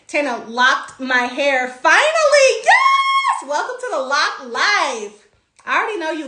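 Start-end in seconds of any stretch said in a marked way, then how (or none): 1.7-3.5 high, loud cheer of joy; thrilled yelling; excited shout. Real, not acted. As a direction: shout it out in delight.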